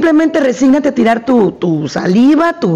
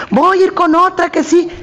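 higher-pitched imitation of an offended customer; mock indignant client; 7 semitones higher than usual. Psychologist imitates women who get annoyed with her, mock indignation. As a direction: mimic a client threatening to leave.

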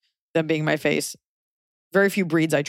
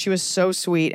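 ordinary talk

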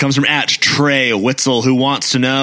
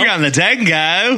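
high, hammy Aussie twang; mock Australian play call; Australian accent; 7 semitones higher than usual. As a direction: put on a playful Australian accent.

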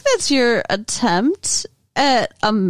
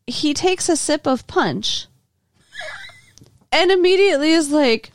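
normal talking voice

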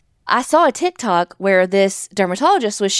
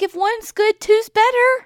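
much higher, silly self-justifying voice; mock naive reasoning; 13 semitones higher than usual. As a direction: play your own clueless logic high. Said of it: "I'm like".

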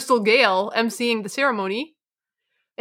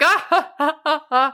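fake laugh; 4 semitones higher than usual. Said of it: "they do this awkward joke".

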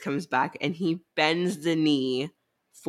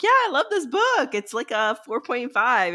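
much higher, louder gushing mimic; gushing cohost; 9 semitones higher, 5 dB louder than usual. Teasing a cohost by mimicking her gushing in a high squeal. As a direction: squeal it like a giddy fan. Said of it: "you were still like".

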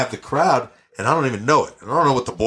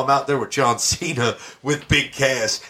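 ordinary delivery

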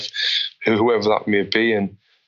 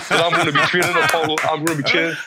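laughing brag; 5 semitones higher, faster than usual. Retold or imitated. Bragging to everyone, laughing.

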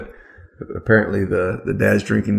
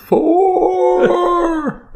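high, long-held "fore" call; drawn-out high mock yell; golfer's warning shout; 4 dB louder than usual. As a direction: holler a warning down the fairway.

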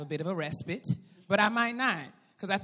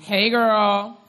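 loud greeting; 4 semitones higher, 12 dB louder than usual. Real, not acted. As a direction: call it out loud and bright. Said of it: "I was loud".

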